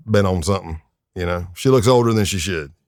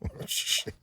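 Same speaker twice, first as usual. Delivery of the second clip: hushed, voiceless mock reaction; stunned whisper; 14 dB quieter than usual. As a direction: whisper it as if quietly appalled. His stunned reaction, retold.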